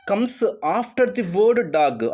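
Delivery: slowly rereading the question. Shown as a demonstration. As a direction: stretch each word of the question. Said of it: "rereads the question". (నెమ్మదిగా మళ్ళీ చదవడం)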